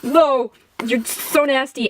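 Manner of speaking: drawn-out, hammed-up disgust; put-on grossed-out groaning voice; mock disgust. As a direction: drag it out in mock revulsion. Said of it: "He's just like".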